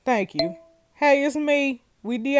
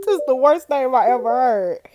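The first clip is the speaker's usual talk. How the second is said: reading through real laughter; cracking up; 7 dB louder than usual. Real, not acted. Reading out a friend's dig at a silly name, cracking up. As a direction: laugh helplessly midway, then push the line out.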